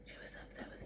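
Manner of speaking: dropped to a whisper; hushed, stressing it; whispered emphasis. Real, not acted. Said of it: "emphatic repeat in whisper".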